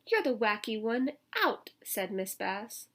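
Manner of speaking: lively storybook read-aloud; reading to children. A narrator's reading. Teacher reading a picture book to children, lively. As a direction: read it out to the kids with bounce.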